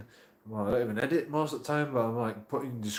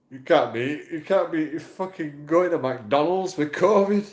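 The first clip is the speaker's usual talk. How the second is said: louder and slower indignant scold; mock outraged telling-off; 3 semitones higher, 7 dB louder, slower than usual. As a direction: mimic a self-righteous scolding. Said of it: "And they went".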